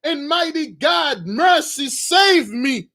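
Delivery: put-on desperate girl begging the prince; screaming plea. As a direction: cry out for mercy from the ground. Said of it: "she said".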